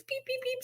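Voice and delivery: high, soft squeaky chick peeps; chick peeping. Retold or imitated. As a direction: peep like tiny baby chicks. Imitating baby chicks peeping.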